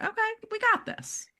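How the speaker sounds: high-pitched little voice of a brain; brain's voice. Acted. Coach voices the brain reading signals as good, high-pitched reassurance. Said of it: "our brain will be like".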